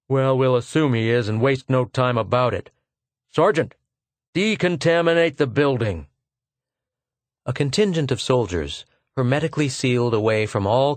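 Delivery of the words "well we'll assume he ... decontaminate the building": barked military order, higher; cold officer giving orders; commanding officer. Acted. Lieutenant ordering sergeant to decontaminate, curt command.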